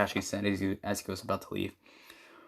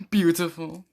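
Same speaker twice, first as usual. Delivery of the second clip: high, louder gushing delivery; gushing fangirl; 8 semitones higher, 5 dB louder than usual. Acted. Sakura introducing her hobbies to the team, gushing. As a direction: gush it out on a high pitch.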